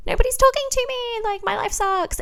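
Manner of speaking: mock your younger self moping on the stairs; mock self-pitying wail. Crying on a staircase every night.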